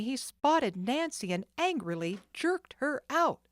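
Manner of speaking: ordinary delivery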